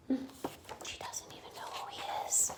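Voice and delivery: soft conspiratorial whisper; whispered aside. Real, not acted. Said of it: "whispered aside to listeners".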